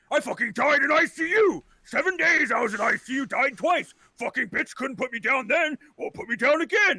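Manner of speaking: put-on high, loud elderly bragging rant; worked-up old-timer voice; cranky old man. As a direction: boast crossly like a ninety-year-old. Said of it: "he looks about ninety years old".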